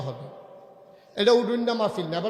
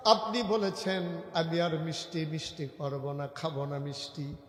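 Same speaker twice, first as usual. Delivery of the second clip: soft sermon storytelling; 2 semitones lower, 6 dB quieter than usual. Acted. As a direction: tell it gently in an even lilt. Preacher intones story low.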